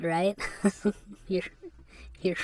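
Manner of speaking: usual speaking voice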